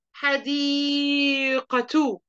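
حديقة drawn out very slowly ending in a clear t sound, hadiqatu; dictation pronunciation. Shown as a demonstration. Mother dictating حديقة slowly with taa sounded. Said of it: "ملتني الكلمة دي".

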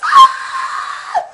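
strained scream with no voice; voiceless scream. Shown as a demonstration. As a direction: scream with a voice that is gone. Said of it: "full out screaming".

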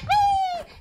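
gleeful high-pitched "wee"; voicing a dog's loud whee; high drawn-out whee. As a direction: squeal a long, sustained whee. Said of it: "He's like".

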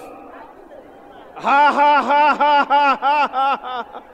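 loud, high, deliberate belly ha-ha; big forced laugh in a long even string; practice laugh. Shown as a demonstration. Preacher demonstrates laughing in time of famine, loud deliberate laugh. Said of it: "Practice a little laugh".

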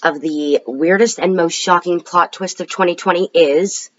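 ordinary talk, nothing marked

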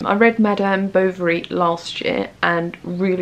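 usual speaking voice

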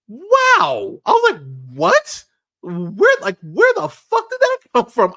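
high, loud stunned outburst; shocked. Retold or imitated. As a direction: blurt it out in total disbelief. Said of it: "I was so shocked".

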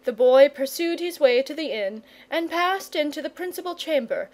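ordinary delivery

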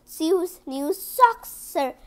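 usual speaking voice

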